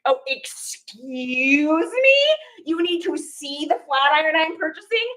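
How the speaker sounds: slow, affronted "excuse me"; slow, indignant put-on disbelief; mock indignant. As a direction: drag it out, offended. Indignant at seller checking item.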